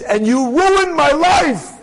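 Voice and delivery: high, drawn-out mock outrage; hammed-up reproach; acted accusation. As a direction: accuse him slowly, pitch lifted. Woman accuses rabbi in retold story.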